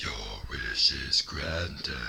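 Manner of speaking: very low, slow menacing rasp; low villain take on the dragon; Doctor Claw voice. Retold or imitated. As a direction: growl it like a cartoon villain. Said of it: "Kinda sounded like Doctor Claw".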